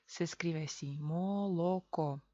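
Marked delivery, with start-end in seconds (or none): 1.0-2.2 molocó drawn out, mo-lo-ko; slow molocó with a full o in every syllable; unreduced reading. Shown as a demonstration. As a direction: read molocó as spelt, syllable by syllable. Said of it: "así se lee, sin reducción".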